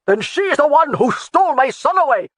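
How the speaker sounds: high, loud, furious accusation; angry accusation. A narrator's reading. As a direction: hurl the blame at her. Angry laird accuses Jamie.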